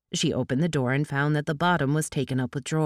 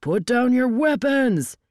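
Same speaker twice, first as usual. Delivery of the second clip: high, slow, forceful command; drawn-out agent's shout; shouted order; 8 semitones higher, 4 dB louder, slower than usual. A narrator's reading. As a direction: shout it at armed opponents. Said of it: "Durek shouted".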